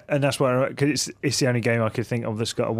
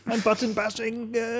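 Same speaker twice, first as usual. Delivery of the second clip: put-on high sheepish kid voice; a kid making an innocent excuse; childish voice; 11 semitones higher, 4 dB quieter than usual. Voicing his childhood self again.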